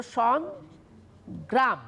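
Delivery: সংগ্রাম in two slow pieces; split into syllables. Shown as a demonstration. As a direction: pause between সং and gram. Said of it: "বা লিখতে পারো".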